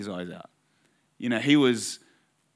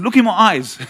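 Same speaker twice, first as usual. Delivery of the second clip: loud, raised-pitch retold urging; insistent command; 7 semitones higher, 9 dB louder than usual. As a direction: press the point, louder and higher. Urges crying student to listen.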